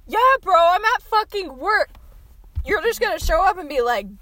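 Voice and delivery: mock-outraged and loud; indignant comeback. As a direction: retell the argument with real heat. Retold quarrel with coworker at work.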